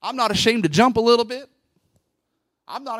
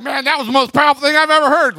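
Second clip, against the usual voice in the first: loud, hammed-up admiration; mock gushing; 4 semitones higher, 9 dB louder, faster than usual. Congregant comes up to the preacher afterwards, mock gushing. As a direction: imitate an overawed fan.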